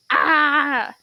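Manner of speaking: loud, open "ah" scream; loud cry. Acted. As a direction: let out a sudden loud cry. Character cry ending the demo.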